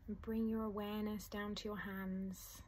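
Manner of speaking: soft, unhurried meditation voice with long pauses; guided meditation. Acted. Teacher guiding listeners through a letting-go meditation, soft and calm. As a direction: ease the listener gently into calm.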